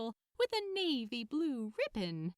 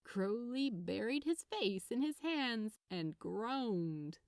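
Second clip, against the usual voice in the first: ordinary delivery, nothing marked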